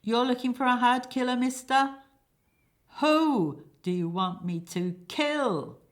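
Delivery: forceful, with heavy stress on "Who" and "kill"; emphatic killer. Acted. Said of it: "emphatically pronouncing the words kill and who".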